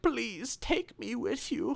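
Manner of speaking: put-on high, slow pleading in a German accent; lightly put-on German accent, pleading. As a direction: beg like a little German dog. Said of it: "really thick German accent".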